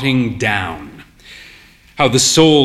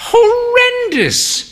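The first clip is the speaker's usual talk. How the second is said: put-on much higher, slow dramatic old lady; an old mother groaning about Kenmare, much higher and slow; grand old mother; slower than usual. A narrator's reading. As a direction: stretch it out in grand disgust.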